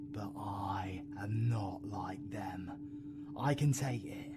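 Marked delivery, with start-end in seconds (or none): 0.1-2.7 slow, soft insistent whisper. Acted. V reassuring El in a slow whisper. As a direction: whisper it slowly, holding firm. Insisting she can take it.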